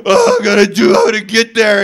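mock desperate Peter rushing to shore; over-the-top Peter. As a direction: melodramatic wail of a man who must get there. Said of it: "he's so dramatic".

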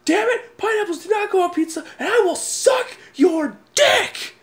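high-pitched, laughing mock scream; screamed for shock value. Shocking a coworker in a pizza argument, high mock scream. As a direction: shriek it absurdly to shock a coworker. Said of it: "and I screamed at him".

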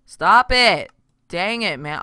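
loud, high burst of annoyance; exasperated yell. Real, not acted. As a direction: yell at it to quit. Snaps at recurring recording alert.

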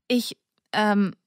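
flustered halting stammer. Acted. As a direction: lose the words mid-sentence. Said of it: "stammelte sie wie ein Vollidiot".

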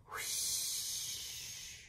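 breathy drawn-out whoosh; soft whoosh sound. A narrator's reading. Boggin answers with a soft wind whoosh. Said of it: "sang Boggin".

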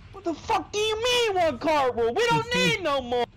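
high-pitched incredulous yell; retold indignant screaming rant; outraged outburst. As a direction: screech it in exasperated disbelief. Retold outburst at the cart rule.

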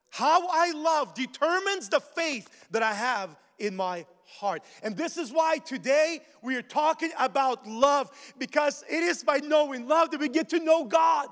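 loud, high, ringing preacher's cry; full-force preaching. Acted. Preacher shouting to the church about love. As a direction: lift the congregation with a big shout.

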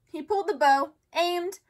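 ordinary delivery

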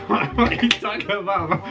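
usual speaking voice

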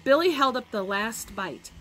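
plain reading voice

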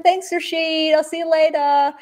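high, overly polite thanks; imitating a forced polite goodbye. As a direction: hold it together while thanking the boss. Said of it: "I'm like".

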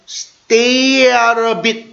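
drawn-out "stay out of it"; slow pronunciation drill. Shown as a demonstration. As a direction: stretch each linked word for learners. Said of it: "발음을 한번 연습할게요".

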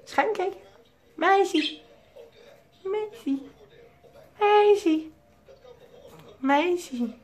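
very high sing-song calling with falling ends. Real, not acted. Owner calling his parakeet Meisje, high coaxing sing-song. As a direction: coo the bird's name up high to win its attention.

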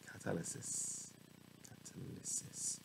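very soft, nearly whispered word practice; under the breath. Real, not acted. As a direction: try catalysis out quietly to yourself. Tries the word under their breath.

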